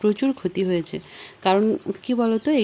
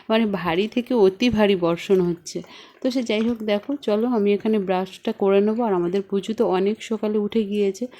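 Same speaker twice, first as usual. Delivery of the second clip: usual speaking voice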